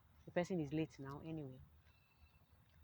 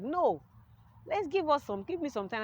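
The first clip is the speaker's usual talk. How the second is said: higher, louder pleading no; urgent plea; 9 semitones higher, 7 dB louder than usual. Retold or imitated. Telling daddy she needs time first, urgent raised plea. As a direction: push back hard, asking for time.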